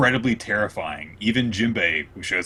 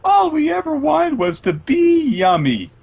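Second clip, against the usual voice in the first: high, loud, sweet sing-song; cheerful little pastries being eaten; high chirpy pastry voices; happy cream puffs; 6 dB louder than usual. Retold or imitated. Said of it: "living cream puffs go".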